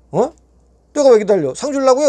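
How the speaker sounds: 높이 치솟는 거부; 절대 못 들어간다고 버티기; 반발하는 항의. Retold or imitated. 기다리라는 말에 반문. (들어가라는 말에 높게 대드는 말투)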